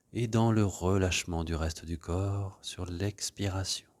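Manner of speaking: gentle meditation voice leading breathing; guided meditation. Acted. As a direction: ease the class into stillness. Leads a guided relaxation.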